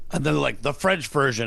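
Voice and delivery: usual speaking voice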